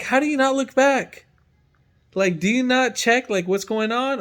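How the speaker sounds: indignant. Retold or imitated. Retold indignant challenge to old man.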